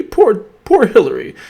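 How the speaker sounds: high, sarcastic sigh of false sympathy; sarcastic poor-thing voice; mock pity. Mocking pity for Hillary.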